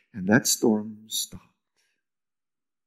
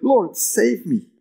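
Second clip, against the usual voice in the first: mock plea; 9 semitones higher, slower than usual. Peter sinking cries out.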